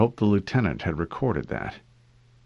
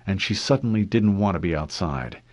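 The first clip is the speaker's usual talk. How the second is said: ordinary delivery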